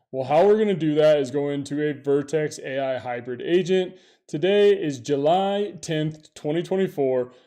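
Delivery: loud, punchy tech promo read; announcer. Acted. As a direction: hype it like an ad. Loud video intro pitch.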